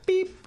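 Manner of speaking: high-pitched imitated beep; a high electronic-style beep made with the voice; answering machine beep. Actor mimicking his phone's message tone, high beep.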